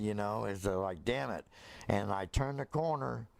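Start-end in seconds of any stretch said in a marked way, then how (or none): none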